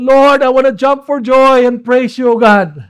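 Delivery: loud, high cry to God; impassioned acted prayer; fervent praise. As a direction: call out to the Lord with exultation.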